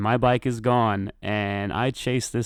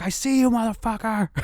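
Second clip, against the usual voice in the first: mock yell; 12 semitones higher than usual. Caller shouts at the thief in the distance, mock yelling. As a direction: holler it at held-back volume. Taunts thief during the chase.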